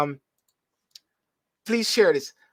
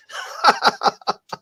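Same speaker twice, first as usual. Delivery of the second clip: breathy laugh; 8 semitones higher, 4 dB louder than usual. Real, not acted. Laughs at own joke.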